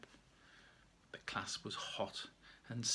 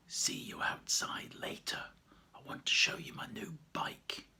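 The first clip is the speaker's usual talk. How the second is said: whispered note; 5 semitones higher than usual. A narrator's reading. Secret note read in class.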